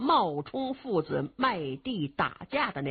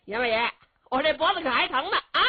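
put-on complaining young thief; 7 semitones higher, 4 dB louder, faster than usual. Young man rubbing his sore neck.

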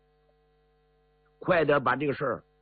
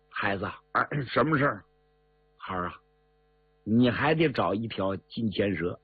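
lightly voiced old Taoist; 5 semitones lower than usual. 诸葛山珍老道劝贾明.

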